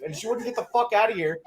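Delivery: ordinary talk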